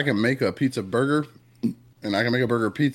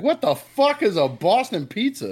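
slow, high-pitched mock outrage at a strange pizza; baffled disbelief; 8 semitones higher, slower than usual. Retelling his reaction to hearing about Boston pizza, slow and baffled. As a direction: drag it out, stunned and incredulous. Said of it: "I was like".

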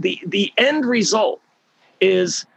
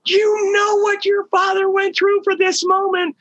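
excited yelling. Retold or imitated. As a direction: yell it across the chairlift, high and thrilled. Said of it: "they're scr".

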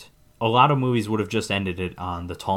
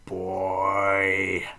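put-on long drawn-out creepy "boy"; Tall Man. Film fan imitates the villain first seeing Mike, creepy drawn-out voice. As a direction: draw the word out in a creepy villain's voice. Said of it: "his deep, creepy voice".

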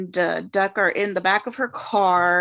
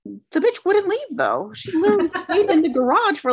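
amused outrage; 9 semitones higher than usual. Real, not acted. Mock outrage about the dove.

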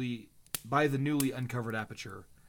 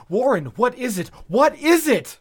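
terrified, much higher and louder; 10 semitones higher, 12 dB louder than usual. A narrator's reading. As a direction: shout it in rising terror. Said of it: "Terrified, I continued to repeat".

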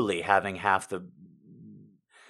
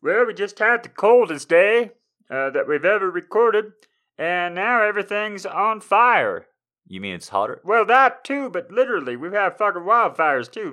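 mock Canadian; 8 semitones higher, 8 dB louder than usual. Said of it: "I can't do a Canadian accent".

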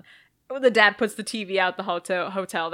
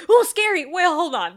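mock fright; 7 semitones higher, 7 dB louder than usual. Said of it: "where it was like".

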